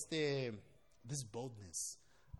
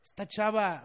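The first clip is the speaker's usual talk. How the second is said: higher, louder acted exasperation; frustrated; 6 semitones higher, 9 dB louder than usual. Pastor's thoughts about the interrupting girl, mock frustrated. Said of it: "mi mente estaba frustrado".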